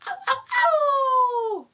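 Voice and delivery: slow, high, loud howls; dog howling. Acted. Reading the howling dog to a toddler, loud howls. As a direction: howl like a sad dog. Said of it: "a dog who was howling".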